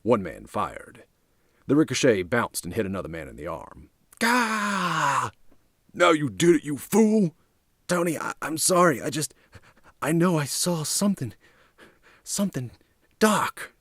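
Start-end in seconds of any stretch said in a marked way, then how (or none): 4.2-5.3 long high cry of pain; drawn-out high yelp, just been shot; pained yell. Acted. Man hit in the arm by ricochet. 5.9-7.3 high, loud, furious rebuke; pained, high-pitched angry yell; angry scolding in pain. Acted. Shot man berates the shooter. 7.9-13.8 high-pitched, halting, frightened thug; nervous pleading with anxious pauses; spooked apology. Acted. Shooter stammers apology, spooked.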